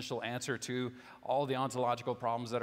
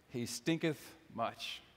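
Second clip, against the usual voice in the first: mock solemn; much slower than usual. Preacher quoting King James to the congregation, slow mock solemn. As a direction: drag out the archaic line for a laugh.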